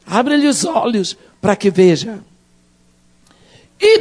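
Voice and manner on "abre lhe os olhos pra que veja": lower, softer, simple prayer; quiet prayer. Acted. Prophet prays for his frightened servant, lower and softer. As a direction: pray it gently, close to God. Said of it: "faça como Eliseu".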